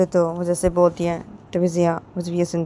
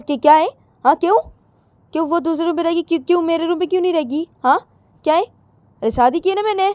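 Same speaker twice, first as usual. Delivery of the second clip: high, loud, indignant shouting; angry outburst; 6 semitones higher, 4 dB louder than usual. Retold or imitated. Jiya objecting to her mother, angry high-pitched shouting. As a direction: demand answers, voice pitched up and loud. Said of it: "तो जिया बोलती".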